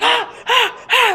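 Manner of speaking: high, short startled yelps; imitated startled screams. Storyteller imitating her boy waking from dead sleep, screaming. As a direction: yelp as if jolted from dead sleep. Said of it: "starts screaming".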